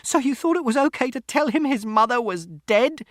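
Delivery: very high, loud accusation; outraged shout. A narrator's reading. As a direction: confront him at the top of your voice. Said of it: "Mother shouted".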